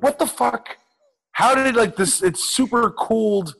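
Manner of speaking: high, startled mock outburst; retold shock. Putting on frozen jeans in the stall, high startled outburst. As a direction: yelp of surprise at the ice-cold pants.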